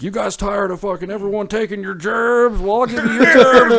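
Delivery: high put-on voice building to a loud yell; mock rabble-rouser. Candidate promising poor people their jobs, hammed-up rallying cry. Acting out Trump riling up voters.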